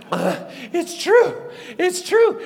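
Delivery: high, slow winded exclamation; breathless announcement. Acted. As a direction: gasp out the news after running. Breathless runners announce the news.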